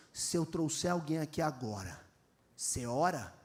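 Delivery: lightly voiced dare; challenging teen. Teen daring the preacher to pray, low.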